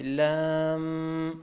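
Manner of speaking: slow لام ending in a held m; stretched letter. Shown as a demonstration. Teacher showing the final m, long لام. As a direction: draw out لام, "laaammm". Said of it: "آخر میں میم کی آواز آئے گی".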